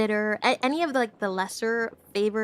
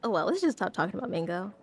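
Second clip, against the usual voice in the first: ordinary talk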